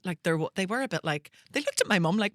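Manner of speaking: normal talking voice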